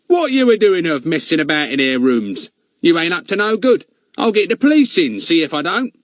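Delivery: loud, high Cockney lad; brash Cockney put-on voice; Cockney accent. A narrator's reading. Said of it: "Fatty put on a real Cockney voice".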